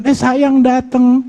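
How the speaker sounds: high, slow, sugary wife's welcome; sweet wife greeting. Acted. Wife sweetly welcoming her husband home, high and slow.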